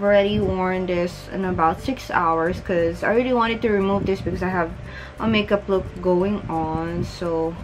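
jaw held by tight strap; talking with chin mask on. Real, not acted. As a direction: lips pushed through mask opening.

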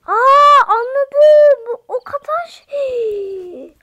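high, loud aha shout; yelling with sudden understanding; excited realization. Real, not acted. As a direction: burst out as it clicks. Suddenly realizes what the enemy is.

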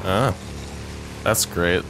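ordinary talk, nothing marked

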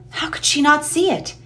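usual speaking voice

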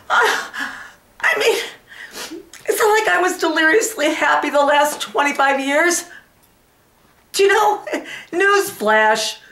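high, worked-up rant sinking into breathy regret; betrayed wife, agitated self-reproach. Acted. Wife blames herself for her husband's cheating, distraught and indignant. Performs monologue from the Women.

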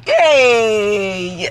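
normal talking voice

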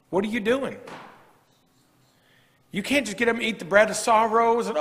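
usual speaking voice

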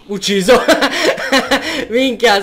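high laughing outburst. Real, not acted. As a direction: cackle through the kill. Kills zombie, laughs.

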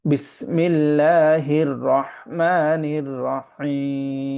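slow, devout drawn-out chant; heartfelt recitation. Shown as a demonstration. Teacher reciting names of God with feeling, slow devout chant. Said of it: "এরকম অনুভব করে".

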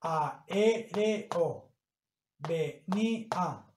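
"Aéreo. Venía." slowly as a-é-re-o, ve-ní-a; syllable by syllable. Shown as a demonstration. Teacher showing vowels in separate syllables, syllable by syllable. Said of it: "se pronuncian de manera en dos sílabas diferentes".

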